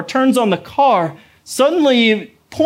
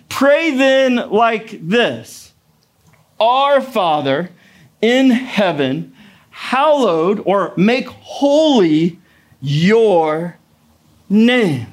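slow, solemn reading of the prayer; scripture reading; much slower than usual. Acted. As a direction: read the verse slowly and reverently. Reads the Lord's Prayer.

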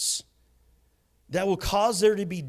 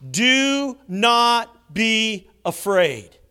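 slow emphatic; 4 semitones higher, 5 dB louder, much slower than usual. Shown as a demonstration. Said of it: "four crucial words spoken by Jesus".

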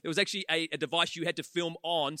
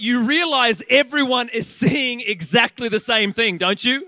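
exasperated wife; 4 semitones higher, 11 dB louder, slower than usual. Retold or imitated.

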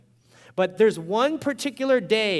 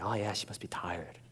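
much lower, softer reasonable thought; soft inner voice; 9 semitones lower, 8 dB quieter than usual. Retold or imitated. Pastor voicing the softer part of himself in the car. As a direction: concede it gently, almost to yourself. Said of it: "I was like".

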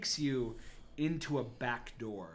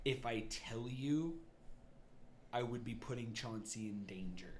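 grave Garrick reply; 8 dB quieter than usual. Acted. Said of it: "He responds going".

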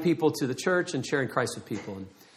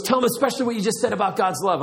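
eager prompting, higher pitch; super excited; 6 semitones higher, faster than usual. Retold or imitated. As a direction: imitate an excited friend urging you on. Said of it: "He was super excited, a little over the top".

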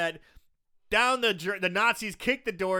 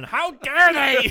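drawn-out, high indignant shout; hammed-up scandalized yell; mock outrage; 8 semitones higher, 13 dB louder, much slower than usual. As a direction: cry out in exaggerated offense. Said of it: "my P C mind started going".

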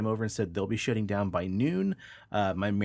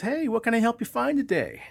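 much higher, louder shopkeeper greeting; cheery manager; 5 dB louder than usual. Acted. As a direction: greet the customer with big false cheer.

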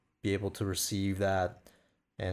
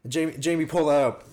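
loud, higher call to a producer; Joe Rogan; 6 semitones higher, 7 dB louder than usual. Retold or imitated. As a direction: call out like a podcast host. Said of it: "a joke from Joe Rogan podcast".

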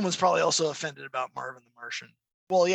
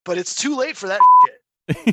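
loud, exasperated burst; 8 semitones higher, 13 dB louder than usual. Real, not acted. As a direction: vent loudly about the guilty dog. Scolding the dog.

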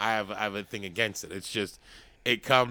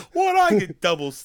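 high, loud put-on voice; 11 semitones higher, 11 dB louder than usual. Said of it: "they're so monotone".

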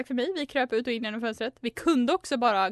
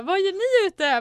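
high, loud mock challenge; imitated aunt's outcry; 9 semitones higher, 5 dB louder, slower than usual. Aunt catching the girls outside at night, mock outcry. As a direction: call out like an aunt who has caught you. Aunt's startled question, retold.

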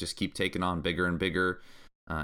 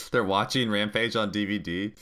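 laughing; 3 semitones higher, 3 dB louder than usual. Real, not acted. Laughing incredulous question.

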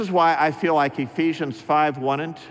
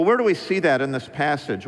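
ordinary delivery

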